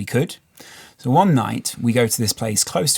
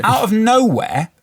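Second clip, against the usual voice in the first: pitched up high and slowed for suspense; higher, slower, suspenseful story beat; dramatic build-up; 8 semitones higher, slower than usual. Real, not acted. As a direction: make the turn dramatic.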